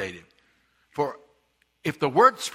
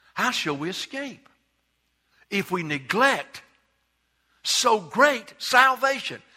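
building emphasis; 5 semitones higher, slower than usual. Shown as a demonstration. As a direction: rise and hammer "so great salvation". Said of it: "it didn't say".

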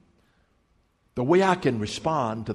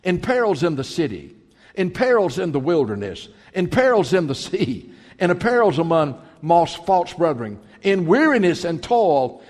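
rising sermon recital of Paul's hardships; preacher building a list with force; preaching cadence; 3 semitones higher, 4 dB louder than usual. Acted. Preacher reading Paul's trials to congregation, rhythmic recital.

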